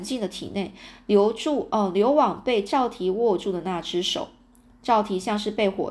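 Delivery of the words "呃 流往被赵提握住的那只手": plain reading voice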